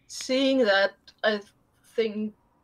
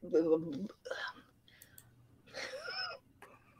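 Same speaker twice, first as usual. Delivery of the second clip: laughing; 3 dB louder, slower than usual. Real, not acted. Laughing through reassurance.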